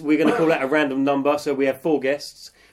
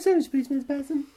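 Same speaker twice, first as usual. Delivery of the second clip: much higher silly squeak; squeaky high voice; 13 semitones higher than usual. Pretend. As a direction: squeak it like a pupil.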